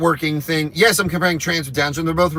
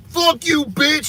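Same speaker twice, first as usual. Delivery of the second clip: high mock yell; retold scream, high and mocking; imitated scream; 11 semitones higher, slower than usual. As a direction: play the drunk guy screaming, pitched way up. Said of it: "He was screaming at this woman".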